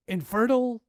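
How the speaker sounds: a restrained imitation of a cry; mild mock yell. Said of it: "She screamed".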